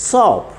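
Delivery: സോപ്പ് high and falling, one syllable; only O sounded. Shown as a demonstration. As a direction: make സോപ്പ് a single O sound, no A. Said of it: "ഓ മാത്രം ഉച്ചരിക്കാനുള്ള". (സോപ്പ് ഒറ്റ സ്വരത്തിൽ)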